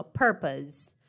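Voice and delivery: "purpose" ending in a z sound, low pitch. Shown as a demonstration. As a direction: stress PUR, end "purpose" with a buzzing Z. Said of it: "tem um som de Z".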